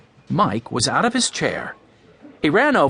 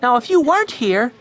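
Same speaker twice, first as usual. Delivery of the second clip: put-on high, playful girl's voice; teasing girl; 11 semitones higher, 4 dB louder than usual. A narrator's reading. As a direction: tease a friend fondly.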